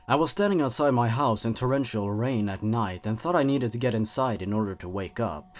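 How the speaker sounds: ordinary delivery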